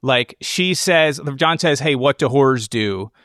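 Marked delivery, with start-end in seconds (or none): none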